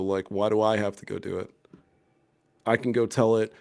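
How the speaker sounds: ordinary delivery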